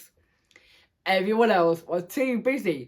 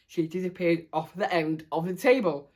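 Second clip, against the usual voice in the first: plain reading voice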